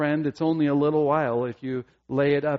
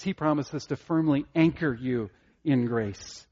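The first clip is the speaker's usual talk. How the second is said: ordinary delivery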